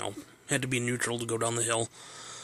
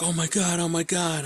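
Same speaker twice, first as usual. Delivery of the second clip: higher, louder faked alarm, hammed-up; dad pretending the car is out of control; mock panic; 7 semitones higher, 4 dB louder than usual. Dad fakes panic, prank.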